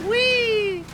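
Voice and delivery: high, loud joyful squeal; gleeful whee. Real, not acted. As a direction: squeal with glee. Gaming stunt, gleeful yell.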